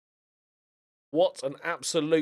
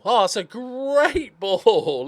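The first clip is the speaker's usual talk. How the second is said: laughing admiration; 7 semitones higher, 5 dB louder, much slower than usual. Real, not acted. Admiring a pass, laughs mid-line.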